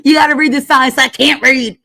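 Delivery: mild impression of an annoyed hot dog guy; imitating the vendor. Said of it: "the guy was like".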